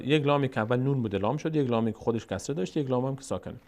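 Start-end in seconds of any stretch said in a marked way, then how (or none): none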